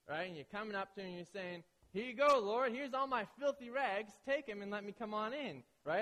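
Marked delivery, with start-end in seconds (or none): 1.9-5.6 high, loud, eager offer to the Lord; eager presenting. Acted. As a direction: pipe up loudly, handing it over with total confidence. Acting sinner presenting rags to God.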